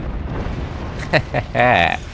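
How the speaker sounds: snickering at an easy win; laugh. Real, not acted. Gloats over an easy win.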